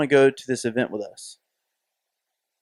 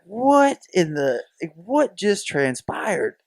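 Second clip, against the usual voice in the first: stunned disbelief; 6 semitones higher, 4 dB louder, much slower than usual. Retold or imitated. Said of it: "Like, I saw".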